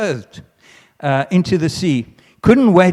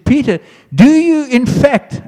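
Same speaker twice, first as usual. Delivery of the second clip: high, loud, pressing question; Jesus pressing Peter; 11 semitones higher, 7 dB louder than usual. Acted. Jesus pressing Peter a third time, high and urgent. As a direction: push the question hard as Jesus.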